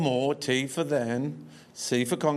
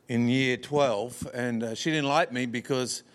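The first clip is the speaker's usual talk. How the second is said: ordinary talk, nothing marked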